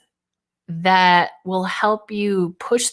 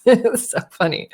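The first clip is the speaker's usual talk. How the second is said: ordinary delivery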